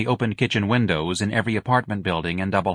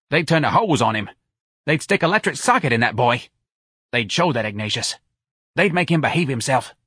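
higher-pitched brash scolding; angry; 6 semitones higher, 3 dB louder than usual. A narrator's reading. Santa berates Irene over her troublesome son, angry. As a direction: lay into your friend about her son. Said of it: "Santa said angrily".

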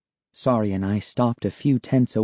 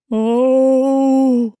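high startled cry of fright; frightened gasp; 6 dB louder than usual. Acted. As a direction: gasp out in terror. Terrified at figure in tent.

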